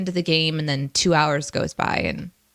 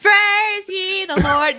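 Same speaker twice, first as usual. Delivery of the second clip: much higher, slow Praise ye the Lord breaking into laughter; laughing, voice cracking; 12 semitones higher, 4 dB louder, much slower than usual. Shown as a demonstration. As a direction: try to chant Praise ye the Lord but crack up. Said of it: "my voice just went totally out".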